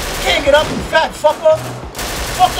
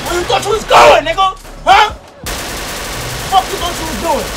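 taunting shout; 5 semitones higher than usual. Real, not acted. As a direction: holler it after a fleeing enemy. Taunts enemy in gunfight.

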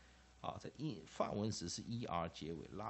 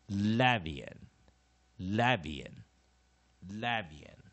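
"Lavian" punched on the first syllable, weaker after; loud front-stressed "Lavian" drill; 9 dB louder, much slower than usual. Shown as a demonstration. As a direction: hit the start of "Lavian" loudly, let the rest fall. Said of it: "Man stress first syllable".

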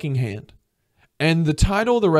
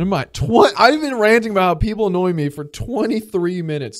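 higher, louder incredulous ranting; really irritated; 6 semitones higher, 5 dB louder than usual. Real, not acted. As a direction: blurt it out in rising exasperation. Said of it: "I'm getting really irritated".

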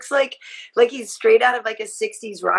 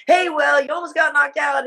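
mock taunt; 6 semitones higher than usual. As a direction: shout a cocky jab. Retelling Michael's taunt.

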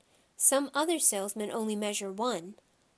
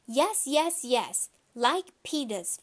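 put-on a mildly louder, higher kid voice; hollering toddler; 4 semitones higher, 4 dB louder, slower than usual. A narrator's reading. Toddler hollering at the shoe salesman, mildly loud. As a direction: holler it like a stubborn little boy. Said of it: "Fudge hollered".

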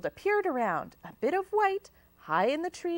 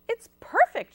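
higher and louder, a hint of witchy glee; cackle; 12 semitones higher, 7 dB louder than usual. A narrator's reading. Watilda finding the pizza job ad, light gleeful cackle. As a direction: gloat over the perfect job. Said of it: "she cackled".

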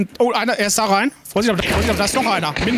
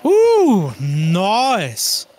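high, loud whoop; excited cheer; 3 semitones higher, 5 dB louder than usual. Real, not acted. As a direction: whoop in triumph. Cheering a kill.

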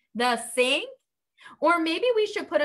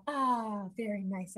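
ordinary delivery

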